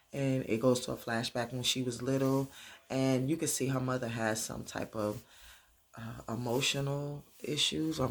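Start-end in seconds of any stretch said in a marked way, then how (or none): none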